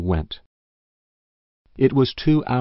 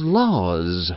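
sighing laws; 2 semitones lower than usual. Acted. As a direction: let it fall away with a sigh. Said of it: "sighed Missus Race".

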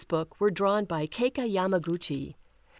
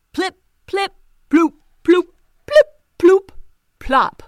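high, loud plopping sounds; splash noises; 9 semitones higher, 13 dB louder than usual. Acted. Toads falling into the bucket, high loud splash noises. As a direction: pop out each splash.

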